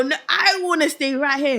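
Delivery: high, loud retold refusal; insistent protest. Child refusing to leave heaven, insistent mock protest. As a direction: protest like a stubborn child, acted out.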